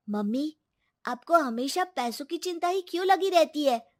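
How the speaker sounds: put-on protesting young son; defensive son. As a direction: stand up to mother for grandma.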